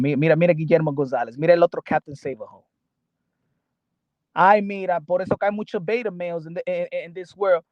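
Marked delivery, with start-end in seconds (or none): none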